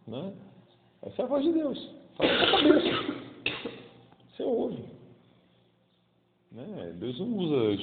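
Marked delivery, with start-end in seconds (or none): 1.0-4.8 put-on high, airy young woman's voice. Preacher voicing God as a young girl. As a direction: play God speaking as a girl. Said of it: "ele fala com a voz de uma menina de vinte e quatro anos".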